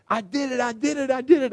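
high, bursting with excitement; fired up. Retold or imitated. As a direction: shout the good news like a proud kid. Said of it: "he comes in all fired up".

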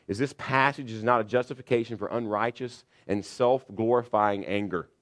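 ordinary delivery

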